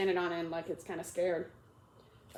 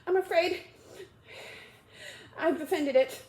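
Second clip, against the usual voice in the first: sorrowful; 8 semitones higher, 4 dB louder than usual. Acted. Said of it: "in a sorrowful tone".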